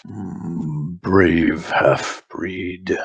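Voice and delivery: very slow, low sneering shaman; orc shaman voice. Acted. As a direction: draw out the mockery. Shaman mocks the threat.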